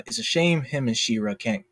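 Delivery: normal talking voice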